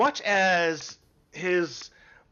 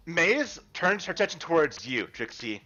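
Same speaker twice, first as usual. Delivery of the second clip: ordinary talk, nothing marked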